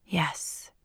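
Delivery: whispered reassurance; calm him with a whisper; a hushed soothing yes. A narrator's reading. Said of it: "he soothed".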